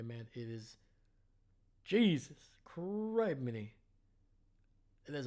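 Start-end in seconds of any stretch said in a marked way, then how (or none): 1.8-3.4 drawn-out high, loud astonished exclamation; a slow, stunned oath; amazed. Real, not acted. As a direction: stretch it out in real awe at his size. Awed by a jacked draftee.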